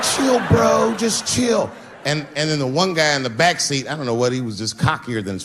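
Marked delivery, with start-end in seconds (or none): 0.0-1.7 put-on high nervous teen. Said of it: "And they were like".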